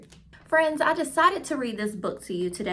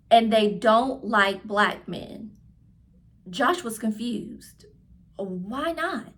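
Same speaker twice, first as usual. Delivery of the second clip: clear, lively read-aloud voice for a class; reading to children; 4 dB louder than usual. A narrator's reading. Teacher reading a picture book aloud to her class, lively storytelling. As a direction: tell the story warmly to young listeners.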